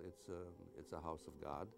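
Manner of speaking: ordinary talk, nothing marked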